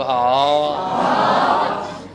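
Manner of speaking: a long, loud, drawn-out haan; stunned surprise. Shown as a demonstration. Teacher shows how a shocked person reacts. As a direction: let the jaw drop in shock. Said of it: "बोलो".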